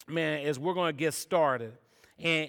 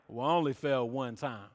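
mock bragging; 7 semitones lower, 6 dB quieter than usual. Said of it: "they were bragging like".

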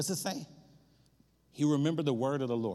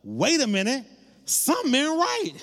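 raised, high-pitched challenge; suspicious servant girl; 7 semitones higher, 4 dB louder than usual. Retold or imitated. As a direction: call someone out, pitch climbing high. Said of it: "a servant girl was in the conversation and was like".

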